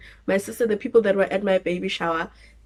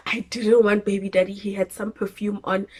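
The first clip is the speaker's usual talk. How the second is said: usual speaking voice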